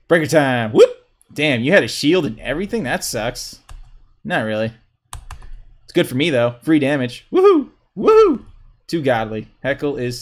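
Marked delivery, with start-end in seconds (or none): 0.7-0.9 quick high rising whoop; little upward whoops, very high; playful whoop. Real, not acted. Player sounding out moves in a match, playful whoop. Vocal effect for game move. 7.3-8.4 high, loud woohoo; excited whoop. Real, not acted. Player cheering a move in a match, excited whoop. Calling out during live gameplay.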